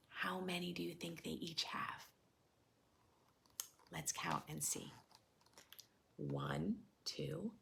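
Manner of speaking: hushed teacher whisper. Real, not acted. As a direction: lean in and whisper the question. Teacher asks children quietly.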